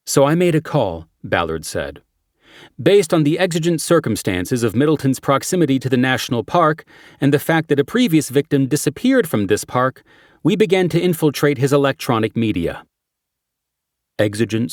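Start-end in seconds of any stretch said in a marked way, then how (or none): 0.1-1.1 lightly raised, brisk detective; brief the team like a cop. Acted. Character addresses team. 2.8-12.8 lightly raised, brisk detective; brief the team like a cop. Acted. Character briefing quote.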